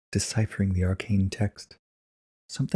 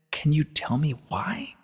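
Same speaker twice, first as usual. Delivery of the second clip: Twilight, timid; 8 semitones higher, slower than usual. Acted.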